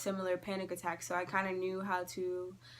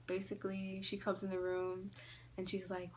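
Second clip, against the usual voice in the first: ordinary talk, nothing marked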